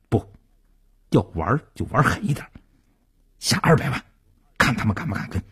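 brisk, determined urging; utterly firm. A narrator's reading. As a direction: say it quickly and decisively, brooking no doubt. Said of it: "用无比坚定的语气".

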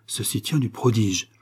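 ordinary delivery

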